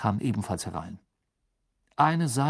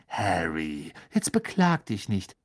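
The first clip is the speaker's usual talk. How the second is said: enthusiastic; 3 semitones lower than usual. Acted. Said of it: "rief Ron begeistert".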